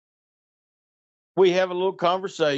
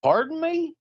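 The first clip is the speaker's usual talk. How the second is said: pitched way up, a hammed-up caller voice; high mock voice; 12 semitones higher than usual. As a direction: squeak it out, playing the startled caller. Caller's voice on the phone.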